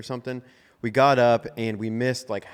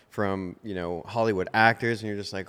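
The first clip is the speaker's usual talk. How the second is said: ordinary talk, nothing marked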